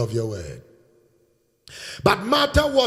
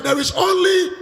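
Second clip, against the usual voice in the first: call to congregation; 10 semitones higher, 5 dB louder than usual. Shown as a demonstration. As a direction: shout it for the church to repeat. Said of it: "shout it one more".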